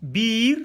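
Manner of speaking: "Biir" with a held, rising ii; sustained vowel in "Biir", tune rising; long vowel. Shown as a demonstration. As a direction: draw out the i of "Biir". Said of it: "Voyez la différence".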